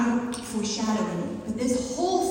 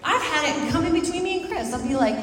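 usual speaking voice

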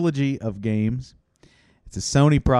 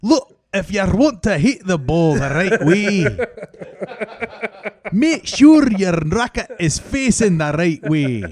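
mock coach yell; 3 semitones higher than usual. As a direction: drill the player like a bossy coach. Coach yelling at Andy.

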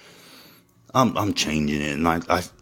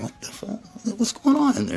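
much higher, baffled outburst; older son hearing the party, high shocked question; shocked older brother. Retold or imitated. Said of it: "it's like".

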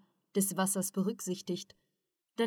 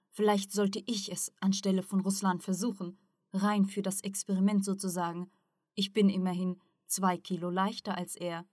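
ordinary delivery, nothing marked

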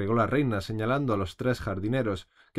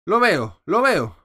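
loud, higher, cutting repetition; angry queen; 10 semitones higher, 9 dB louder, slower than usual. A narrator's reading. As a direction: cut them off in fury. Queen sees the roses. (la reina furiosa, alta y cortante)

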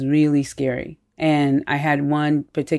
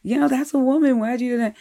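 exasperated yell acted out at a much higher pitch; retold yelling; 10 semitones higher than usual. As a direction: imitate a husband telling off his wife. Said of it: "he starts yelling at his wife".

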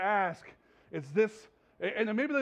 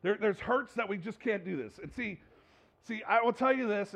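ordinary talk, nothing marked

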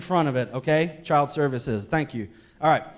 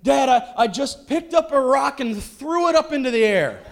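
put-on high, laughing kid voice; the boy confessing with a giggle; sheepish boy; 10 semitones higher, 6 dB louder than usual. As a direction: son sheepishly explaining to his dad, pitched high. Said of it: "he's like".

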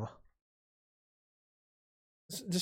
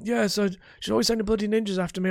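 higher, quick put-on voice; ex-husband Harold; 7 semitones higher, 3 dB louder, faster than usual. Shown as a demonstration. Gamer tests an easier ex-husband voice, higher and quicker. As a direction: complain fast in a pitched-up character register. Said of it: "That'll work, that'll work".

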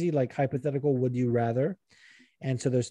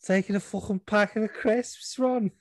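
put-on high Scouse accent; mocking Scouse schoolgirl; Scouse Hermione; 7 semitones higher than usual. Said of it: "give her a Scouse dialect".

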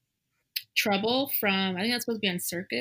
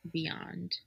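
breathy whisper of awe; 10 dB quieter than usual. Real, not acted. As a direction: whisper it reverently. Whispered praise of the tour.

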